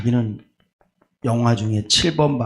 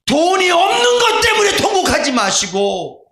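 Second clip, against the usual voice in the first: high, loud exhortation; preaching at full force; fiery preaching; 12 semitones higher, 4 dB louder than usual. Acted. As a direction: shout the call to weep and pray. Exhorting about money worries.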